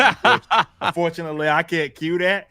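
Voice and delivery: high voice breaking into a laugh. Real, not acted. Host can't cue the clip of himself, laughing. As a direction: talk through real laughter.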